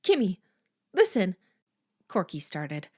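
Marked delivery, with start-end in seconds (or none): 0.0-1.4 urgent plea. Acted. Corky pleading with possessed friend.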